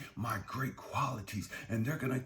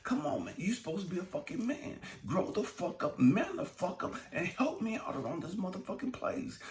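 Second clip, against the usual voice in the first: fed-up scolding; 2 semitones higher than usual. Acted. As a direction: act out a partner at the end of their patience. Acts out fed-up partner scolding.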